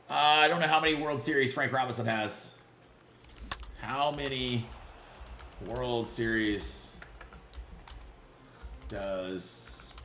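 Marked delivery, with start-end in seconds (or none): 3.8-9.9 slow musing search. Real, not acted. Slowly reading search aloud.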